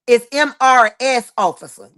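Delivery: M R S spelled letter by letter, stressing R; letter-by-letter M R S Officer; spelling it out. Shown as a demonstration. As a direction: spell out M R S, hit the R. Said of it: "not M I S".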